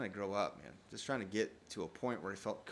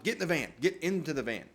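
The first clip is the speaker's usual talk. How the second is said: raised, urgent plea to get in the van; urgent call; 5 semitones higher, 5 dB louder than usual. Retold or imitated. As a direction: hurry him into the van, loud and pressing. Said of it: "I just wanted".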